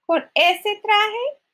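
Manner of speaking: high, drawn-out scornful questions; scornful stepmother. Acted. Stepmother to Cinderella about her dress, slow high scorn. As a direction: stretch each scornful jab, pitched high.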